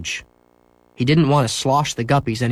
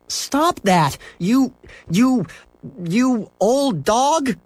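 high, slow sputtering scold; angry boy; 12 semitones higher, much slower than usual. A narrator's reading. Boy scolding his dog, high and sputtering.

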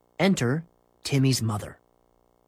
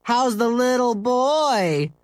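high, drawn-out jeer; yelling; 11 semitones higher, 5 dB louder, slower than usual. Acted. Classmates teasing Henry about his part, loud drawn-out yell. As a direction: taunt loudly, stretching the words. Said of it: "they yelled".

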